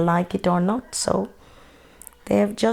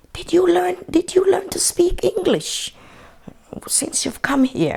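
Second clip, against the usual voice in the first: high mock-surprised English asker; imitating English locals; 11 semitones higher than usual. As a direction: voice the amazed locals, pitched up. Retold question from English people.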